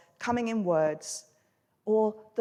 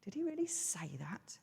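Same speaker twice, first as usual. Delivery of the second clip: very soft, insinuating question; sowing doubt; 2 semitones lower, 13 dB quieter than usual. Acted. Talking snake making Eve doubt God, softly insinuating. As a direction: plant the doubt quietly, rising on "really". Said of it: "make Eve start to doubt God".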